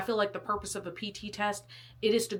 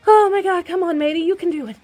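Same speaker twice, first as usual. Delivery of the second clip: loud, high self-urging through the strain; straining pep talk; 9 semitones higher, 11 dB louder than usual. Real, not acted. Urging herself through the plank, loud strained pep talk. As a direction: cheer yourself on while muscles burn. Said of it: "I can't talk anymore".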